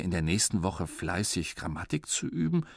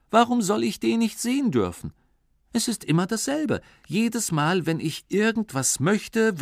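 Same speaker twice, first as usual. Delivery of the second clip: put-on high, indignant child whine; whiny complaint; 11 semitones higher than usual. Sulking boy complaining to himself, high whiny. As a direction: protest how unfair it is.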